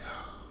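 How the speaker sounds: soft, breathy huff imitating the cashier; exasperated huff. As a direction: let out an annoyed, wordless huff. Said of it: "she, like, huffs".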